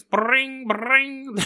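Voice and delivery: mock ringing of an old phone; high mock phone ring; phone ring noise. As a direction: sing out bring bring like a bell.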